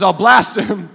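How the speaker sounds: loud cocky trash talk; boastful giant. Retold or imitated. Goliath threatening the Israelites, loud and cocky. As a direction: throw the threat out big.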